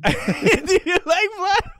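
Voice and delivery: high, loud, drawn-out vampire cry; slow, high and loud monster voice; mock vampire. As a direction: stretch it out like a hammed-up ghoul. Joking vampire interview question.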